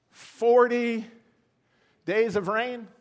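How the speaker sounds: a loud, high "Forty" hammered home; emphatic preacher on "Forty"; preached emphasis. Acted. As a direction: punch "Forty" like a revelation. Preacher hammers a key number.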